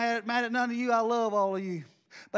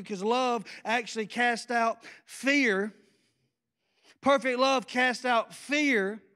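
slow preaching emphasis; slower than usual. Acted. Preaching key verse slowly.

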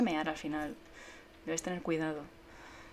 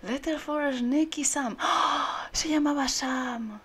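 high, loud, slow theatrical wonder; hammed-up amazed reading; dramatic excitement; 8 semitones higher, 6 dB louder, much slower than usual. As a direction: read it like a big reveal.

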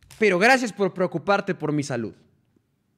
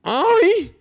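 much higher, louder hammed-up moan; mock moan; 13 semitones higher, 5 dB louder than usual. Viewer asks streamer to moan, hammed-up high moan. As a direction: fake a silly moan on request. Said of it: "así le hago".

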